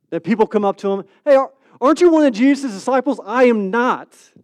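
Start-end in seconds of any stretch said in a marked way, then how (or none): 1.2-3.2 loud, quick, high-pitched question; accusing challenge. Acted. Bystanders confronting the disciple by the fire, accusing. Acts people accosting Peter. 3.2-4.3 loud, slow, raised denial; emphatic denial. Acted. Disciple denying Jesus to the crowd, emphatic. Peter's louder second denial.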